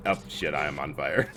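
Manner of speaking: normal talking voice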